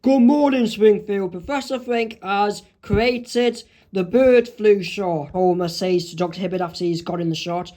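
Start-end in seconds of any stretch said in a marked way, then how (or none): 0.0-5.3 loud, high opening, slowed announcer delivery; Ken Portman. Acted. News anchor announces the bird flu shot, loud high greeting, then slow. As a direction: hail the town, then announce it slowly. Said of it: "what Ken Portman says on the news".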